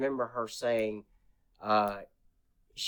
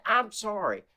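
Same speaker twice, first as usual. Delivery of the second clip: imitating Heather; 10 semitones higher than usual. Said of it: "and she said".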